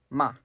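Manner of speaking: MA dropping sharply; fourth tone. Shown as a demonstration. Teacher gives the word for scold, "ma" sharply falling. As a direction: make "ma" fall quickly. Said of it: "And the fourth tone".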